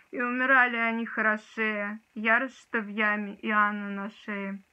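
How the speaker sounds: reciting a poem with dramatic force; dramatic poetry declamation. Shown as a demonstration. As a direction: declaim it like on stage. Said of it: "сейчас тоже его прочитаю".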